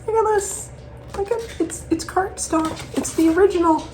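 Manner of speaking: high, hushed marvelling; excited. Real, not acted. Excited about cardstock original.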